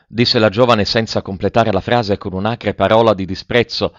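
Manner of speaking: usual speaking voice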